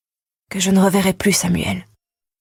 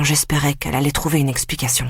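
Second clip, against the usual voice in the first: plain reading voice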